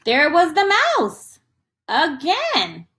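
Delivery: put-on spring the surprise on the kids; surprised reveal. Teacher revealing the mouse again to children, surprised.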